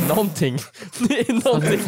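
high, rising unease as something seems wrong; a nervous author realising the book is wrong, high alarmed voice; mock alarmed. Acted, spotting the mistake mid-interview, uneasy and high. Acting Viktor's mounting unease.

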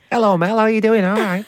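high, squeaky northern squirrel chat; chirpy little northern squirrel says hello. Pretend. Co-host keeps up the high northern squirrel voice to the host. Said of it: "northern squirrel".

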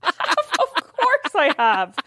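drawn-out incredulous have I; feigned offense, slow and high; mock indignant. Mock offended at obvious question.

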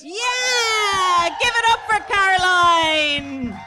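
high, loud whoop of welcome; cheering shout. Real, not acted. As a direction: cheer her onto the stage. Host cheering a guest on.

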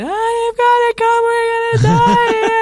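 very high, loud, slow shout in English; panicked shout. Retold or imitated. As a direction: yell down the phone in panic. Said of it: "roper til hun dama på andre".

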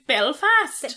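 Belfast said the local way, Belfast with a hard p; lightly imitating a Belfast local; how they say Belfast. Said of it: "they say".

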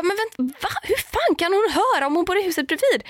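mock shock, pitched well up; incredulous. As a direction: think it aloud in baffled disbelief. Own disbelief retold.

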